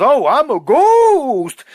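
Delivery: high, loud, slow goofy happy-go-lucky voice; mocking Jaga. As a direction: lampoon a dopey cheerful ghost, drawn out. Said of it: "being like a happy-go-lucky".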